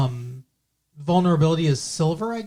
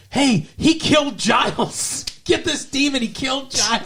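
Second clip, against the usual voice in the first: mock tattling cry; 8 semitones higher than usual. Villain calling to the heroine to get the demon, mock tattling cry. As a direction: call out high as if pointing the finger, half laughing.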